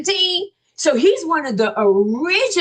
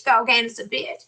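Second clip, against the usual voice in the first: ordinary talk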